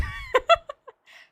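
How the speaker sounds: very high, loud burst of shrieking laughter. Real, not acted. Streamer bursts out laughing at chat calling it rigged. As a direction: cackle out in sudden delight.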